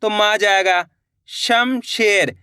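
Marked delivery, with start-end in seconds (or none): 1.3-2.3 Shamsher stretched slowly, syllable by syllable; slow teacher blending; slow sounding out. Shown as a demonstration. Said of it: "शम बोलेंगे".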